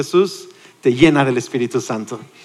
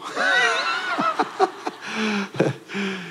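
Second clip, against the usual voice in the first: crowd laughter; 5 semitones higher, 7 dB quieter than usual. Real, not acted. Congregation laughs at joke.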